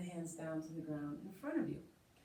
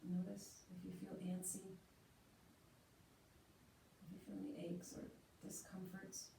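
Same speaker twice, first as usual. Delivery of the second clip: guided meditation; 8 dB quieter than usual. Acted. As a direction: settle them quietly into stillness. Leads seated meditation.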